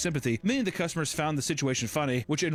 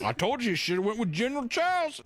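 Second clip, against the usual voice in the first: higher, lightly voiced scold; nagging Midwest spouse; 8 semitones higher than usual. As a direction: tease the husband. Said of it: "Midwest couple".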